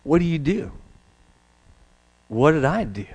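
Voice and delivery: normal talking voice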